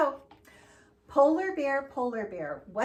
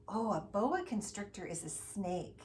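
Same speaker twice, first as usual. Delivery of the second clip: ordinary talk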